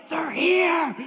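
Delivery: high, hiding wife's alarmed hiss; hammed-up scared wife; mock panicked whisper-shout. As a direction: play her cowering and alarmed. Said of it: "And she's like".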